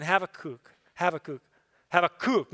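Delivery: "Habakkuk" as hah-vah-KOOK; last-syllable stress. Shown as a demonstration. Preacher letting the name sink in, end-stressed. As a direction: let the final KOOK of "Habakkuk" land each time. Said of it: "emphasize the last syll-, syllable".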